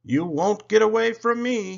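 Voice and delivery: high, loud, gleeful sing-out; Thomas, whistling happily. A narrator's reading. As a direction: call it out happily in pursuit. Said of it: "he whistled happily".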